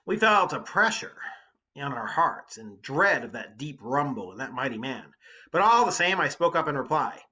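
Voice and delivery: loud, lively read-aloud of the epic; dramatic reading. Acted. Teacher reading the Odyssey to students, dramatic read-aloud. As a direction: read the poem to the class with energy.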